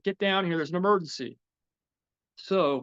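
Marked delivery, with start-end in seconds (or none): 0.0-1.4 urgent partner call. Retold or imitated. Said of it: "Antonio's like".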